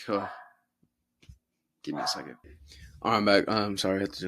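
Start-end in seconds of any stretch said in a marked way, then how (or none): 1.8-2.4 whispered aside off mic. Real, not acted. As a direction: murmure « give me a second » en aparté. Whispered aside dealing with dog.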